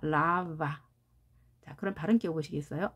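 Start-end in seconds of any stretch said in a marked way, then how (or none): 0.0-0.7 lava with a long LA, weak va; stress on first A. Shown as a demonstration. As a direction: lean on LA in lava. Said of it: "앞에 A에 강세가 있습니다".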